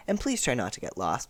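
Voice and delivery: lightly voiced kind teacher; a hint of a friendly teacher, easy and light; kindly teacher asking nicely; gentle teacher. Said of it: "'Cause if it was Mister Ajayi, he would have said".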